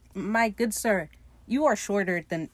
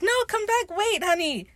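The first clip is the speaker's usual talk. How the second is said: calling after him, pitched way up; high pleading call; 12 semitones higher, 3 dB louder than usual. Retold or imitated. Fang and Pete calling after the fleeing Lucius, high and pleading. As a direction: beg him to come back, high. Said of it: "And Fang and Peter are like".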